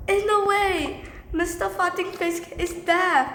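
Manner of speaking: high, loud mocking disbelief; acted mockery. Student makes fun of the teacher before class, acted mockery.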